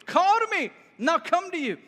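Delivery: calling out high and loud across the distance; Peter. Acted. Disciple calls from the boat to Jesus on the water, calling out. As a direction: project it upward, a bold call to someone far off. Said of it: "Peter calls out to Jesus".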